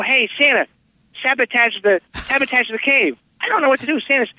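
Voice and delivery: high-pitched cartoon elf. Method actor recording elf dialogue, high elf voice. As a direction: pitch it well up, like a little elf. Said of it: "whenever I recorded my elf dialogue".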